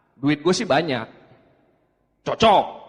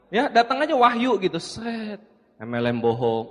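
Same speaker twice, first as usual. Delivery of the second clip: ordinary talk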